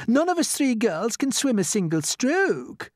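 high, slow, loud lament; panicking Miss Spider. Acted. Miss Spider speaks for three girls who cannot swim, dismayed.

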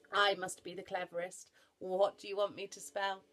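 loud, blustering voice; self-important big animal; boastful rhinoceros. Acted. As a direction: push the words out with weight.

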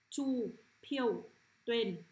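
slow, deliberate words for pupils to echo; teacher slowly modelling single words; read-after-me model. Shown as a demonstration. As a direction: say each word separately, drawn out. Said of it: "Bạn đọc lại theo cô nhé".

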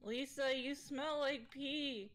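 slow, drawn-out taunt at the ghost; mock the ghost slowly. Real, not acted.

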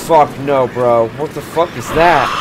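loud alarmed yells at the monster; freaked-out loud shouting; panicked yelling. Real, not acted. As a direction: yell it out in real fright. Player panics at scary creature.

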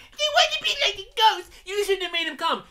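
high, whiny imitation of crying; exaggerated tearful mimicry; mock wailing. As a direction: mockingly wail back her accusation. Mockingly repeats the character's tearful line.

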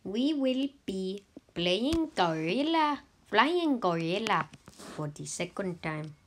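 normal talking voice

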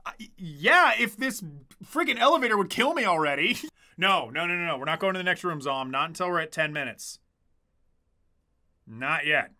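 ordinary talk, nothing marked

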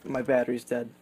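ordinary talk

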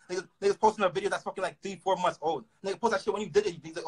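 usual speaking voice

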